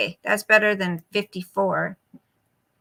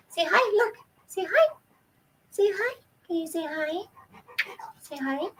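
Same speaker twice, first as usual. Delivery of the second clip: much higher squeaky pet voice; 8 semitones higher, 6 dB quieter than usual. Real, not acted. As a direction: coax the dog to say hi. Coaxing dog to greet viewers.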